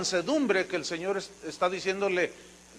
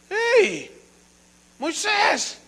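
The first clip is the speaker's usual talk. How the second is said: high, mocking hail; mocking call; 13 semitones higher, 4 dB louder, much slower than usual. Pharaoh's court jeering at Moses as he enters. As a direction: jeer at the humble visitor.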